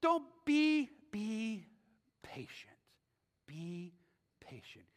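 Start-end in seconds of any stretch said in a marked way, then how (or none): 1.1-4.8 soft whisper, much softer. Real, not acted. Pastor urging older women toward patience, in a soft whisper. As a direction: hush it, almost unvoiced, like a gentle plea. Pastor softly repeating advice.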